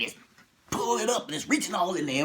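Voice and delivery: ordinary delivery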